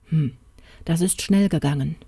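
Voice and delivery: usual speaking voice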